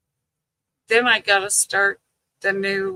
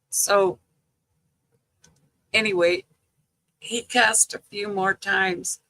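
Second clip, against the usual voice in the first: normal talking voice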